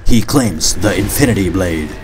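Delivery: nature documentary voiceover; calm, grand narration; documentary narrator. Acted.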